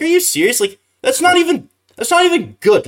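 high, incredulous mock reaction; exasperated disbelief. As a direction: scoff at it like you can't believe how lame it is. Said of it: "I'm like".

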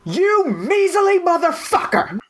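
high, raging yell at an officer; enraged soldier bursting through the door; furious scream. Acted. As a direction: scream it in fury, voice cracking high. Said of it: "What do you say?".

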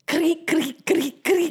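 high squeaky creak noises; creaking sound. Pretend. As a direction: imitate a creaky hinge. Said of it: "Yeah, that's not bad".

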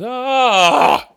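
high hammy cry; anguished wail. Acted. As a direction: cry out like being stabbed. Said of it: "being stabbed, or having an orgasm".

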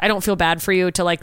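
ordinary talk

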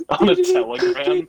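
high laughing squeal. Real, not acted. Laughing joke reply.

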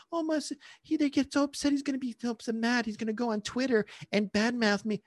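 high, softened fretting voice; mock worried owner. Mocks worried owner's imagined fears.

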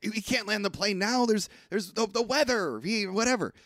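a hint of panic, played lightly; mock alarmed. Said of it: "everyone's like".

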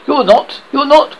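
high, loud repeated denial; frantic storybook protest; hoarse cry. A narrator's reading. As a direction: cry out in agitated disbelief. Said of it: "Hook cried hoarsely".

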